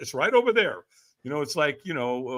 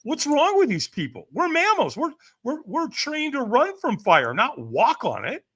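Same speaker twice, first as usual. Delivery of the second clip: high incredulous thoughts; baffled inner voice; 8 semitones higher than usual. Retold or imitated. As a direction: marvel at the madness. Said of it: "my mind is going".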